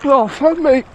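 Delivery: loud, high gasping yells in icy water; cold-shock shouting. Real, not acted. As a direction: yell out from the freezing cold. Gasping in freezing river water.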